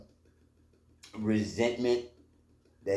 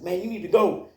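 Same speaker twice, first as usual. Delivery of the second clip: lightly voiced owner, higher and louder; a hint of a firm store owner; 11 semitones higher, 5 dB louder than usual. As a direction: order him off the premises. Store owner ordering him away.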